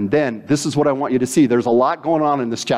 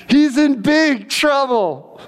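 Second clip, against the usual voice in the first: high, slow, amused through laughter; laughing; 12 semitones higher, much slower than usual. Real, not acted. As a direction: chuckle it out high.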